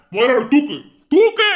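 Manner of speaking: loud command jumping high; agitated shouted command; urgent order. Acted. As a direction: order them to lift her at once. Urgent orders in acted emergency.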